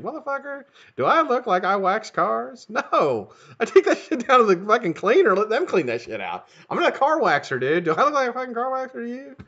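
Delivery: high-pitched indignant rant at the neighbor; mock indignant rant. Telling off the neighbor over car waxing, high indignant rant. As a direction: squeal your outrage at being told to wax.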